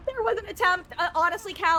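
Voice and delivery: high, loud, fast banter; loud animated reply; excited exclaim. Real, not acted. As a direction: excitedly joke back.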